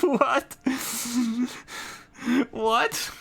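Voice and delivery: a sharp, very high burst of disbelief; incredulous what. Retold or imitated. Reviewer reacting to the crew shrugging off a tank hit, shrill disbelief. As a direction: squeak it out like you can't believe your ears. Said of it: "I'm like".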